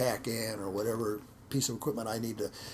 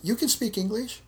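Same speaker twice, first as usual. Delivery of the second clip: amazed question; 12 semitones higher, 5 dB louder than usual. Retold or imitated. Discovers student speaks English.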